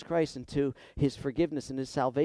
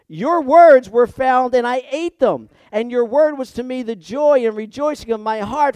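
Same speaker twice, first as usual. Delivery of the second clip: loud, higher, impassioned reading; fervent scripture reading; 8 semitones higher, 12 dB louder than usual. Acted. Pastor reading Jeremiah's prayer to congregation, loud and fervent. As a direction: proclaim the prayer with full force.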